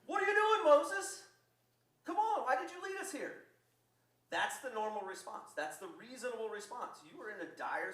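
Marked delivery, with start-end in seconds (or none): 0.0-3.5 high, loud panicked protest; freaking out. Acted. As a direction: blurt it out in a mock freak-out, voice shooting way up. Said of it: "Freak out".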